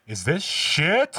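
high, loud, drawn-out question; appalled mock shout. Retelling the dad finding the smeared walls, appalled shout. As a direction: let disbelief climb into a yell. Retold movie line, escalating.

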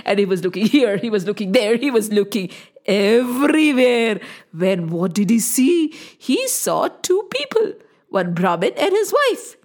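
ordinary delivery, nothing marked

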